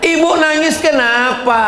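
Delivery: high laughing call to the mother; laughing shout. Acted. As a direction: tease the crying mother loudly. Preacher asks mother at wedding.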